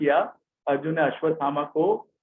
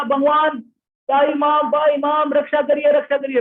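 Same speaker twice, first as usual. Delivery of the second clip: high, loud frantic cry for protection; desperate plea; 8 semitones higher, 4 dB louder than usual. Acted. Pregnant Uttara begging the Lord to save her child, high frantic plea. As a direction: beg for rescue in panic. Uttara runs to Krishna for help.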